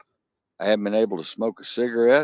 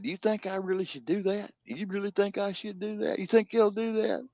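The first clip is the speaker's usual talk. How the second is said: timid simpleton; 11 semitones higher, 6 dB quieter than usual. Retold or imitated. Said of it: "the brother said".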